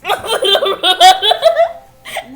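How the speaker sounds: slow loud laughing warning. Real, not acted. Daughter laughing, about to run.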